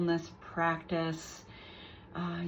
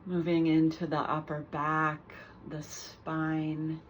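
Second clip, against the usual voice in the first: calm guided meditation with long pauses between phrases; guided body scan; 4 dB quieter than usual. Real, not acted. Teacher guiding listeners through a mindful body scan, unhurried meditation voice. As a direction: lead them gently through the body, leaving space after each phrase.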